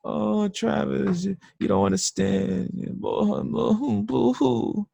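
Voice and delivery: high, slow, whiny boo-hoo voice; mock crybaby whine. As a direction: whimper like a crybaby to mock a complainer. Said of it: "if someone's like".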